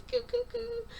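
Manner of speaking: put-on high little baby coo noise. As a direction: coo softly like an infant. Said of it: "he makes this little coo noise".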